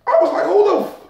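high, loud mock disbelief; incredulous squeal. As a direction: squeak out a stunned who. Incredulous retell.

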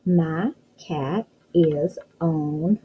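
My cat is on read word by word very slowly; slow sounding-out reading. Shown as a demonstration. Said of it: "let's go back and read".

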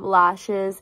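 ordinary delivery

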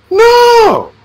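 long, high-pitched "no"; emphatic no. Real, not acted. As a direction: draw out a high, emphatic refusal. Emphatic refusal of preaching on earth.

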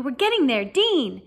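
teacher shouting encouragement in class; excited shout. A narrator's reading. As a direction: shout it over the noise. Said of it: "shouted Lupin".

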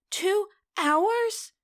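high, loud squeaking disbelief; startled excited squeak. Acted. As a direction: squeak it out in shock. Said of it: "Telly squeaks".

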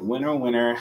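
normal talking voice

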